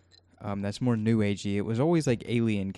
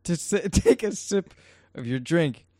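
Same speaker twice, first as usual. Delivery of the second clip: talking through laughter; breaking up with laughter; laughing; 8 semitones higher, 4 dB louder than usual. Real, not acted. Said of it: "I, I am laughing".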